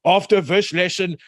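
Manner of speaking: put-on high, hammy South African accent. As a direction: idiot South African preacher accent, slow at the end. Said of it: "I'm doing my best idiot South African accent here".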